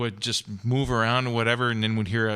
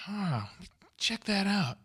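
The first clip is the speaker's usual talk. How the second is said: higher-pitched, lightly acted curiosity; softly mock-intrigued, pitch lifted; retold intrigued discovery; 10 semitones higher, 4 dB quieter, slower than usual. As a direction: act out quietly noticing something odd. Retold moment of puzzled discovery.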